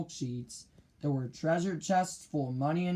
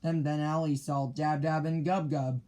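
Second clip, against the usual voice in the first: ordinary delivery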